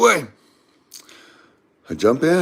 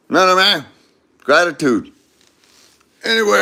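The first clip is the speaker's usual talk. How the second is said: normal talking voice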